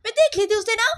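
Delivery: very high, wailing mother's voice; distressed reproach. Acted. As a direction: plead and scold in a high, upset pitch. (परेशान माँ की ऊँची, रुआँसी आवाज़)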